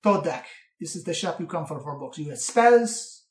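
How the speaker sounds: put-on accented old gnome, deliberate pauses; see off a customer as a quirky keeper; theatrical shopkeeper, rising at the end; old bookkeeper's parting advice. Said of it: "take the gold and say".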